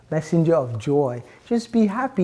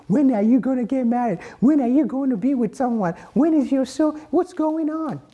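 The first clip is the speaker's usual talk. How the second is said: nosy relatives; 9 semitones higher than usual. Retold or imitated. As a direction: nag like aunts at Thanksgiving dinner. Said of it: "Everybody's asking me at Thanksgiving".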